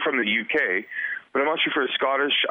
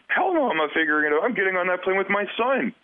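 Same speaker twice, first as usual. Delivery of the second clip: mom's defiant retort; 9 semitones higher than usual. Retold or imitated. Mother refusing to leave her son, indignant and higher. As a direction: throw it back at the paramedic, no way you're stopping me. Mom fighting paramedic for seat.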